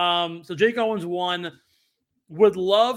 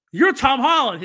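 higher, louder, awestruck blurt; starstruck recognition; 8 semitones higher, 7 dB louder than usual. Retold or imitated. Fan at the gate recognizing the actor, starstruck and loud. Marvel fan meets Tom Holland.

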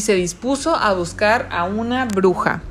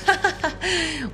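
high, soft acted laugh; short storybook ha-ha-ha; street sweeper's chuckle; 7 semitones higher, 5 dB quieter than usual. A narrator's reading. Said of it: "mientras se reía".

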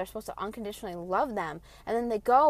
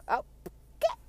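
meek reply; 4 semitones higher, slower than usual. Retold or imitated. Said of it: "I'm like".

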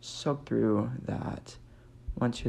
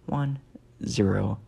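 spelling it out; 7 dB louder, slower than usual. Shown as a demonstration. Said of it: "That's M N".

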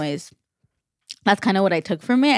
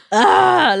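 disgusted groan; 11 semitones higher, 7 dB louder than usual. Retold or imitated. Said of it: "she was like".

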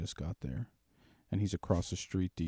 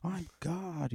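put-on higher, giddy drunk boast; drunk elation; 8 semitones higher than usual. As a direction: crow it high like you own the road. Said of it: "You're like".

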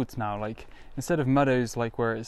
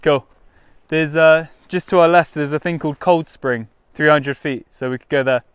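loud, raised call; calling from far away; 3 semitones higher, 10 dB louder than usual. Real, not acted. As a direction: project the voice across the field. Explaining to friend at distance.

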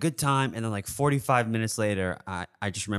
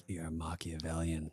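soft, lower aside; inner thought; 5 semitones lower, 12 dB quieter than usual. Pretend. Private thought after thanking a coworker, soft low aside. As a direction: drop it under your breath, as if only thinking it. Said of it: "in my head".

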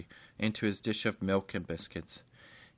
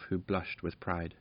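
ordinary delivery

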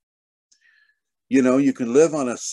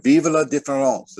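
French phrase; 4 semitones higher, 4 dB louder than usual. Shown as a demonstration. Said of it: "as they say in France".

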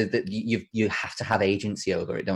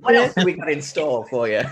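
teasing, laughing; 7 semitones higher, 5 dB louder than usual. Acted. Joins in the joke, teasing.

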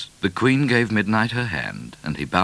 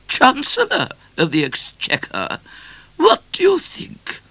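very high, airy queen voice; grandly consult a minister, pitched high; regal Queen; 3 dB louder than usual. A narrator's reading. Queen consults her Chancellor.

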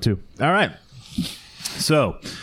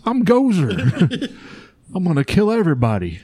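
villain voice; 4 semitones higher than usual. Acted. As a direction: ham up a cartoon villain. Voicing Gozer the villain.

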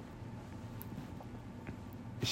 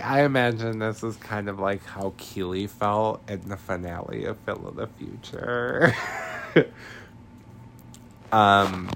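ordinary talk, nothing marked

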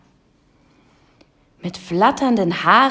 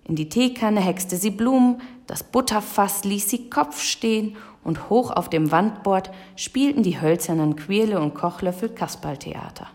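ordinary delivery, nothing marked

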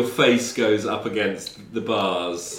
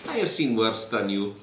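put-on Russian-accented prisoner; 3 dB quieter than usual. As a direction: brush off the threat, accented. Prisoner defies the officers.

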